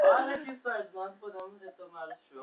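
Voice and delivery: put-on drunk voice. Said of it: "com essa voz de bêbado".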